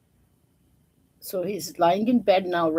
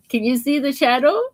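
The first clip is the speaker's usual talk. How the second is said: ordinary delivery, nothing marked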